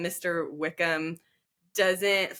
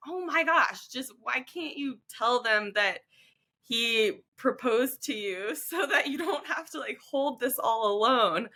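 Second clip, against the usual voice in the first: higher-pitched mock exasperation; exasperated plea; 6 semitones higher than usual. Reader urging Elizabeth to tell her aunt and uncle, exasperated. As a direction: plead with a character as a frustrated reader.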